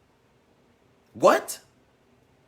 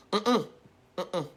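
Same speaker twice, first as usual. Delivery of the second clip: ordinary talk, nothing marked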